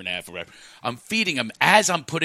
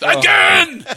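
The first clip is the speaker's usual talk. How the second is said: put-on high, loud toddler yell; thrilled toddler demand; 8 dB louder than usual. Toddler thrown in the air, demanding it at a high shout. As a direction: holler it like an insatiable kid. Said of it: "he's going".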